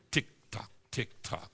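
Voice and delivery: soft ticking watch sound; hushed mock clock tick; whispered tick-tock.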